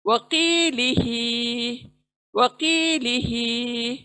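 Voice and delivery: वकीलिही with की and ही long, व and ली quick; slow tajweed reading of वकीलिही; drawn-out recitation. Shown as a demonstration. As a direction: stretch की and ही in वकीलिही. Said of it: "की को खींचना है".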